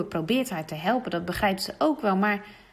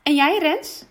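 much higher and louder, sure of herself; full of confidence; 8 semitones higher, 7 dB louder than usual. Acted. Petra answering Jan about passing, full of confidence. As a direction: toss it off as if it's a given.

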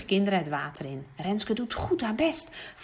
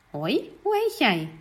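slow, gentle and kind; friendly greeting; 4 semitones higher, slower than usual. Acted. Juf Ellen greeting the upset girl, kind and slow. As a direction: greet a shy child kindly.

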